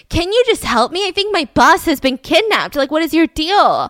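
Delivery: high, loud retold frustration; exasperated plea. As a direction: demand help, acting out fed-up urgency. Coworker exasperated with police.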